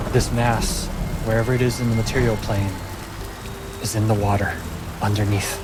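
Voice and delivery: measured fantasy narration; story narration. A narrator's reading. Game master telling players what Poppy finds. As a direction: paint the storm scene for the table.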